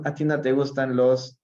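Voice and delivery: usual speaking voice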